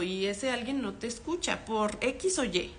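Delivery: ordinary talk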